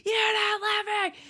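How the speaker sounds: high, strained imitation of a scream; mock yelling. Crazed girlfriend yelling at him, mock high scream. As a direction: screech it high without full volume. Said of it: "she starts yelling at him".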